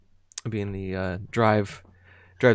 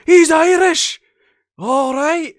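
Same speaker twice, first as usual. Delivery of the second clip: very high, loud thrilled yell; excited shout; 12 dB louder, slower than usual. Retold or imitated. Retelling Jacksepticeye's thrilled yell, high and loud. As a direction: cheer it out in high delight. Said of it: "He's like".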